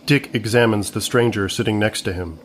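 usual speaking voice

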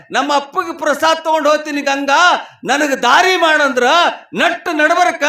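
high, sustained sermon storytelling; full-voiced preaching. Real, not acted. Preacher telling of woman asking river for passage, full-voiced preaching. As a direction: proclaim the miracle to the congregation.

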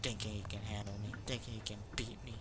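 low hushed muttering; whispering. Real, not acted. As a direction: breathe it under your breath. Muttering alone in dark game.

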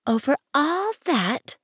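high-pitched, amazed question; questioning little prince. A narrator's reading. Prince marvelling at the king's realm, high and wondering. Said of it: "asked the little prince".

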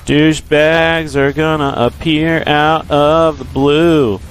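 drawn-out repeat. Shown as a demonstration. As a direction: stretch out "Douchebags are gonna appear out of the blue" like a slow announcement. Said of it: "repeats the line drawn out".

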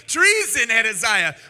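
king cries out to his ally; fleeing shout. Acted. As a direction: yell it while running away. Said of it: "fled shouting".

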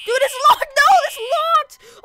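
high, loud cry of alarm; alarmed outburst. Pretend. Player finds the exit locked mid-game, alarmed. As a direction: panicky gamer yell, dragging it out.

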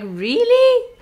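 high, loud disbelieving really; surprised. Retold or imitated. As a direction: shoot back in sharp disbelief. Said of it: "And I was like".

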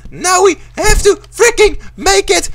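high, loud frantic urging; panicked yelling. Real, not acted. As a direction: urge it on in rising panic. Panicking near the finish.

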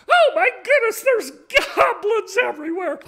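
high, loud cry of horrified surprise; alarmed exclamation. Acted. Lady sees goblins through the magic glasses, loud high alarm. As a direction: shriek it like a startled lady in a tale.

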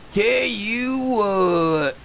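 slow, soft drawn-out tell you what; slow drawl. Acted. Gamer savoring his catchphrase, slow soft drawl.

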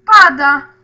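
głośny okrzyk zaskoczenia. Real, not acted. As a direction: wykrzycz to nagle. Zaskoczenie deszczem w grze. (głośny okrzyk)

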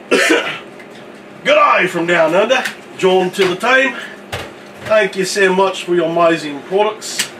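hammed-up Crocodile Dundee Aussie, drawn-out greeting; broad mock Australian accent; full Australian accent. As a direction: go full outback Australian, in character. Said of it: "You cut full crocodile Dundee".

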